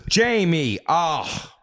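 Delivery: high, exasperated mock mother; hammed-up motherly dismay; appalled old mother. Mother reacting to her son's messages, mock appalled. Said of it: "And she'll be like".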